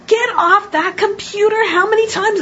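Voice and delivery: high, loud furious scream; yelling, blowing up. Acted. Parent finally blows up at child on the computer, screaming. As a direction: blow up and scream it at the kids. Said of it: "they start yelling and screaming".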